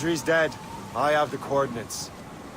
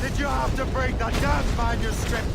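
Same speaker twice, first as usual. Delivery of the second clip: angry shout; 9 semitones higher, 5 dB louder than usual. Acted. Calling out across the ruins.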